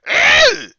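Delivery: very high, loud angry shriek; furious cry. Acted. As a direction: scream out in fury. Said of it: "a furious cry".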